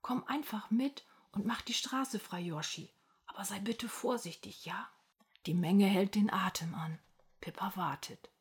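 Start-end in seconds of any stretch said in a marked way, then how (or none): 0.0-4.9 soft, coaxing whisper; hushed, gentle pleading. A narrator's reading. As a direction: whisper to soothe a frightened animal. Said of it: "flüstert Pippa".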